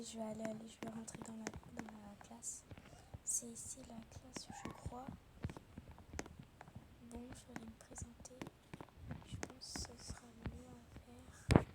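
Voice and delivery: soft whisper to herself; whispered aside. Acted. Pupil whispering to herself before entering the class, hushed. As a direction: whisper it as if nervous about going into the classroom.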